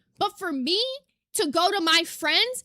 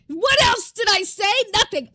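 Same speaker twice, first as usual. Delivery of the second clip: loud, exasperated rhetorical question; indignant shout; 3 semitones higher, 6 dB louder than usual. Real, not acted. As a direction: shout it out in disbelief. Streamer ranting loudly.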